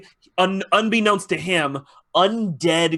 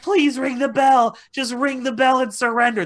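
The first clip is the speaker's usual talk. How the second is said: high, loud mock begging; exaggerated high-pitched plea; pleading; 9 semitones higher, 3 dB louder than usual. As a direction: beg like a desperate crowd, voice pitched up. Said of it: "are begging the queen".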